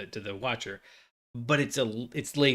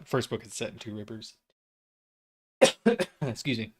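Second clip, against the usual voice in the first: normal talking voice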